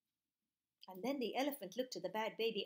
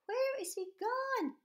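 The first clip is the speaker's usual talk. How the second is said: high, crying worried mum voice; 11 semitones higher, much slower than usual. Acted. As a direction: wail it like a frantic mother. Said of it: "looking very worried like this".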